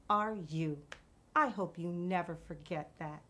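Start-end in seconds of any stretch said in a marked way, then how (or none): none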